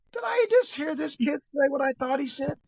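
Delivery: high, incredulous retelling; stunned, can't-believe-my-ears question. Retold or imitated. Stunned by kid's swearing.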